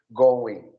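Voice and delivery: "going" said as "goin", no G sound; ending without G. Shown as a demonstration. Teacher shows the ending of "going" with no G. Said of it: "Esse G não tem pronúncia do I N G".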